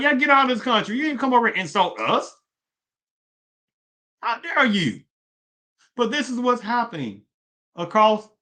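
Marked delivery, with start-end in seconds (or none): 0.0-5.0 high-pitched mock indignation; imagined indignant foreigner. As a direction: act out an offended local telling outsiders off. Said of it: "People in other country, country be like".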